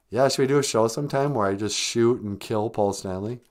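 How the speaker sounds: ordinary talk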